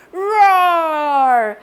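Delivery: dinosaur roar. Acted. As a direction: roar to scare a friend. Dinosaur roars at Ellie.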